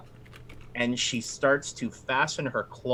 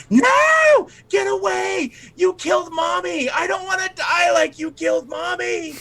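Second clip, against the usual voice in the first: put-on very high, shrieking terrified child; screaming; 4 dB louder than usual. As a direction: shriek in panic at your mother's killer. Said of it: "she starts screaming".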